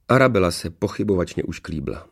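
plain reading voice

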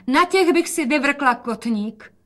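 high, slow call. A narrator's reading. Mother exclaims at the daughter's high heels, calling out. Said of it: "a volala".

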